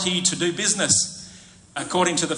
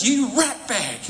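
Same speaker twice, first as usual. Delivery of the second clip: harsh scolding father; 7 semitones higher, slower than usual. Pretend. Imagined harsh father scolding son.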